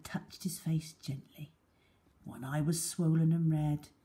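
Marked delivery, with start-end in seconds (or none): none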